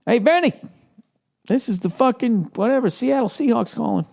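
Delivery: high-pitched mock phone caller; hammed-up call to a retired player; team calling up. Acting out a team's call.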